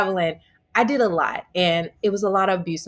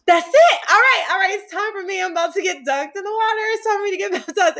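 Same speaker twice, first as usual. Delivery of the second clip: gasp, then rush it out much higher with excitement; a thrilled girl, sure her time has come; excited, high; 13 semitones higher, faster than usual. Retold or imitated. Feels the chills at church.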